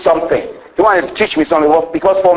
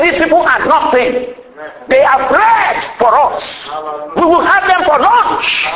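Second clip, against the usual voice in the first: bold voice of faith; 8 semitones higher than usual. Retold or imitated. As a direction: proclaim it with fearless confidence. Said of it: "Caleb, he had some faith".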